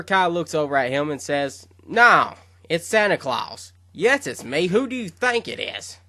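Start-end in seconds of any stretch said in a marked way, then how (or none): 1.9-6.0 put-on sarcastic old god. Sarcastic reply to Shin.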